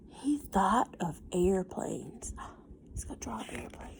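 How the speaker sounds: hushed breathless whisper with a gasp; excited whisper. Real, not acted. Building suspense for the child.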